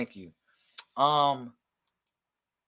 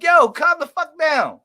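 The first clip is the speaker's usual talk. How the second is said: exasperated; 10 semitones higher, 8 dB louder, slower than usual. Retold or imitated. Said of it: "I be like".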